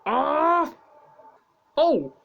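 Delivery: loud startled exclamations; surprised cry. Real, not acted. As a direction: react in shock. Reacting to another incident.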